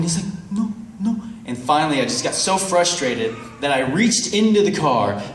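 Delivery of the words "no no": high little imitated denial; evasive beggar. Beggar not even checking his pockets, feigned innocence. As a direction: deny it in a high, innocent put-on voice.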